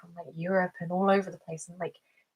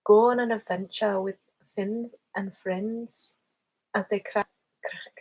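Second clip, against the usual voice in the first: Irish accent slipping into Scottish; 3 dB louder than usual. Shown as a demonstration. Streamer testing an Irish accent, slipping into Scottish. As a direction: attempt Irish, let it drift into Scottish. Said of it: "it's going into Scottish".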